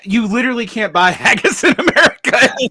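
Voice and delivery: high, loud words through laughter; real laughter while talking. Real, not acted. Reacting to co-host's fake haggis animal story, laughing loudly. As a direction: crack up mid-sentence.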